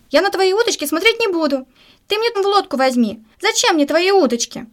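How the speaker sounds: a hint of a protesting kid; lightly voiced pleading boy. Boy answers the angler.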